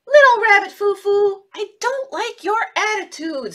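high, loud scolding fairy voice; stern fairy. Acted. Good fairy scolding Little Rabbit Foo Foo, in a high stern voice. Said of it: "And she said".

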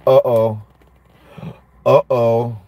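low, dropped uh-oh of dawning guilt; uh-oh realization. Retold or imitated. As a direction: drop the voice as it dawns on you. Realizing own debt.

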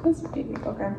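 whispered. Real, not acted. Whispered worry.

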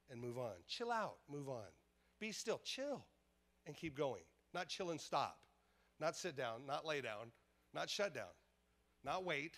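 normal talking voice

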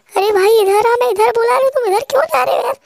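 high, loud, fast calling-over voice; high girlish teammate. Acted. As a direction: shout him back your way in a squeaky girl voice. Teammate calls him over.

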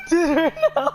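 high helpless laughter. Real, not acted. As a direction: laugh it out, can't stop. Laughing at friend's threats.

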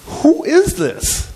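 very high, drawn-out question; awestruck disciples. Acted. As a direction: ask it in acted, terrified amazement. They were terrified.

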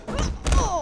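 loud, high yelp; acted pained cry. Fighter taking blows, loud yelp. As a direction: cry out as if hit.

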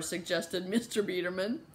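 ordinary delivery, nothing marked

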